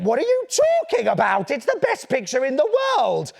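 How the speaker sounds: put-on high-pitched, outraged little boy; indignant protest. Boy defending his picture to his mum, indignant outcry. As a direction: protest in hurt disbelief, pitch jumping up.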